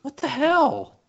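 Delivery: very high-pitched, comic outrage; incredulous. Real, not acted. Parent reacting to a costly Lego set, incredulous. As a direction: squeak it out in disbelief at the cost.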